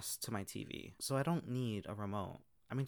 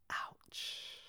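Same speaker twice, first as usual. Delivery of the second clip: breathy whispered wince; a soft pained whisper; whispered ouch; 6 dB quieter than usual. Real, not acted. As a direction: whisper it like it stung. Reacting to a painful comment.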